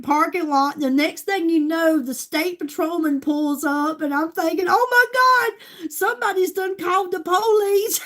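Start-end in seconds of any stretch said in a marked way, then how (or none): 4.7-8.0 mock alarm. Thinking the police came for her.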